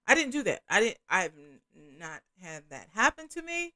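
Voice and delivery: normal talking voice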